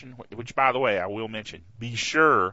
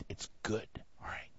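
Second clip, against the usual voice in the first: whisper; 14 dB quieter than usual. Real, not acted. Whispered aside.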